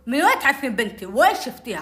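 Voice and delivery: retold loud, puffed-up demand; loud, blustering. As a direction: imitate a father blustering at someone. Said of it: "كذا بصوت عالي نافخ عليها".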